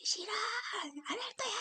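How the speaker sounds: 높은 목소리로 떼쓰는 아이 흉내; 싫다고 소리치는 꼬마처럼. Acted. 아이가 거부하는 장면 연기. (놀잇감 정리를 거부하는 아이, 높은 목소리로 떼쓰며)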